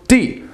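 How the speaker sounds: "D" short, high and falling; abrupt high falling "D"; sharp fourth tone. Shown as a demonstration. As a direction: throw out "D" with a quick drop. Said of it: "Fourth tone".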